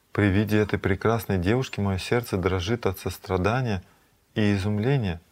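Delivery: normal talking voice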